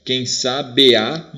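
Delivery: "sabe a" as slow "sa-bea", final vowels linked; syllables with joined vowels. Shown as a demonstration. Teacher showing one spoken syllable, "be a" linked and drawn out. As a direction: scan "Quem sabe a" slowly, fusing "be" and "a". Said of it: "Você fala uma vez só".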